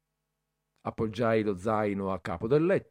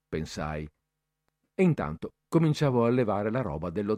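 ordinary delivery, nothing marked

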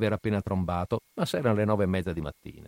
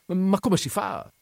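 disgusted disbelief; 10 semitones higher, slower than usual. A narrator's reading. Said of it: "disgusto e sorpresa".

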